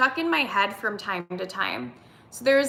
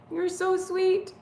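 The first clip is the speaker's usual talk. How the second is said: cooing to cat; 8 semitones higher, slower than usual. Real, not acted. Cooing to her cat on stream.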